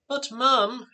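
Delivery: put-on high-pitched teenage protest to mum; exclaimed protest. A narrator's reading. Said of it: "Anthea exclaimed".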